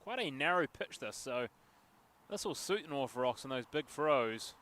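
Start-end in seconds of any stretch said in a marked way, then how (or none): none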